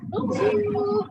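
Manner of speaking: "Bonjour" in a French accent, vong-zhoo; French greeting. Shown as a demonstration. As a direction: show the class how "Bonjour" sounds. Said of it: "kasih tahu dulu sedikit bahasa Perancis". (ucapkan "Bonjour" dengan logat Prancis)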